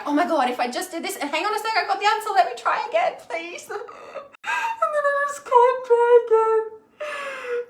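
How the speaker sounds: high-pitched, hammed-up pleading breaking into a wail; mock frantic bargaining. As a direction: plead desperately, climbing into a mock crying wail. Demonstrating frantic bargaining in grief.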